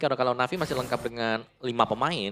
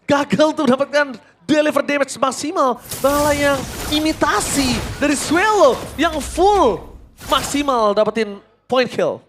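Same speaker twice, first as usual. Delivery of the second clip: much higher, louder screaming casting; hype play-by-play; 9 dB louder than usual. Real, not acted. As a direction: scream the teamfight call. Second caster calls teamfight.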